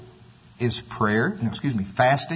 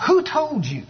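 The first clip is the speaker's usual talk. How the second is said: incredulous question; 11 semitones higher than usual. Retold or imitated. Skeptical neighbor questions Abraham.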